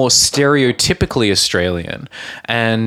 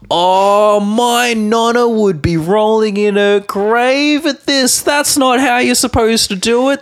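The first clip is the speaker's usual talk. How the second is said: outraged Italian gatekeeper; 10 semitones higher than usual. Acted. Podcaster mimicking Italian food commenters, hammed-up outrage. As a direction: wail it like a scandalised purist. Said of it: "some fucking gatekeeping Italian motherfucker saying".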